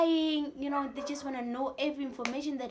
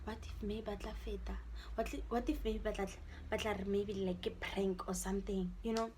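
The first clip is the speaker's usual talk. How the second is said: hopeful murmur; 4 semitones lower, 8 dB quieter than usual. Retold or imitated. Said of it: "I'm like".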